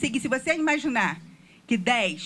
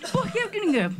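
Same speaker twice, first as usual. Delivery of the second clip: higher, laughing through the protest; laughing disbelief; 10 semitones higher than usual. Retold or imitated. Retelling his reply to neighbors who thought him foreign, laughing disbelief. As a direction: laugh off the idea of being foreign. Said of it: "Eu falei assim".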